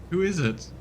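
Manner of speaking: ordinary talk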